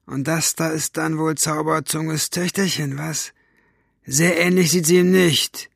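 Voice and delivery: high-pitched, catlike mocking henchman; sneering villain. A narrator's reading. Basta sizing up Zauberzunge's daughter, sneering villain. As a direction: size the girl up mockingly, in a villain's voice.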